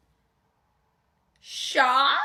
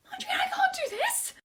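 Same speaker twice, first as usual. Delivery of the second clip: very high, pleading squeal; high-pitched stage-fright panic; panicked plea. Acted. Panicked refusal as boyfriend arrives.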